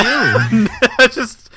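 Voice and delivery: laughing. Real, not acted. Laughing at friend.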